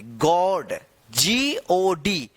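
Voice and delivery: ordinary talk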